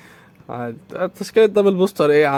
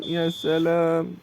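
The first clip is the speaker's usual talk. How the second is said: slow, softer drawn-out mock praise; sarcastic drawl; 6 dB quieter, much slower than usual. Real, not acted. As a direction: stretch "سلام" with dry sarcasm. Sarcastic reaction continues. (سخرية هادئة ممطوطة)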